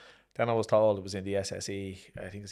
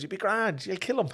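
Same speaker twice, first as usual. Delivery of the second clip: high, breezy, hammed-up; imitating the coach's casual reassurance; mock reassurance; 11 semitones higher, 5 dB louder than usual. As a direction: chirp it like a careless pep talk. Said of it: "And he goes".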